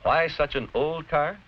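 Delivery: ordinary talk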